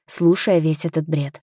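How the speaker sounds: usual speaking voice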